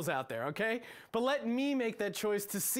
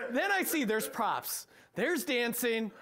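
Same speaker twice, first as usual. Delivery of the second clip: usual speaking voice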